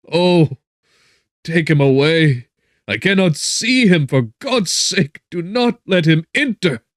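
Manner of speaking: loud, high, anguished pleading; cry of agony. A narrator's reading. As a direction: scream it out as if the killer is at the door. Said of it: "cried out in agony".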